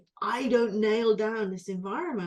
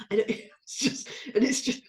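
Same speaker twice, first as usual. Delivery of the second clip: breathy laugh; 3 semitones higher, 5 dB louder than usual. Real, not acted. Laughing through distress.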